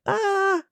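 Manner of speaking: a high siren wail, mimicked mid-story; siren imitation. The storyteller copies the siren heard in the background with a high wail. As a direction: swoop your voice way up like a passing siren. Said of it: "the sirens in the background being like".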